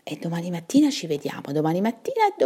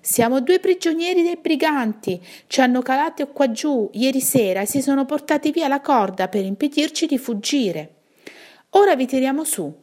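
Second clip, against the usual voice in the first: raised, urgent pleading voice; agitated prisoners; 9 semitones higher, 5 dB louder than usual. A narrator's reading. Two prisoners in a well explaining their plight, agitated. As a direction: explain high-pitched, as if calling upward. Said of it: "voci concitate e rabbiose".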